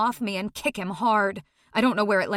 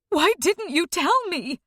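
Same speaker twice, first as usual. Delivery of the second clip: higher distraught plea; mother's pained voice; 8 semitones higher than usual. A narrator's reading. Mother asking her daughter why she kept silent, distraught. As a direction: ask it hurt and shaken.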